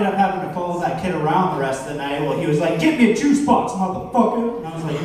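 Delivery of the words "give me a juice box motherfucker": put-on high-pitched demanding kid voice; mock kid giving orders, pitched up; bossy kid. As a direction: bark it out like a bratty six-year-old. Kid bossing comedian around at party.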